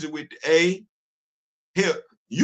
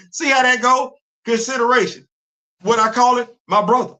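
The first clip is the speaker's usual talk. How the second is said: greeting brother; 7 semitones higher, 7 dB louder than usual. Shown as a demonstration. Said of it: "See how I interact with him?".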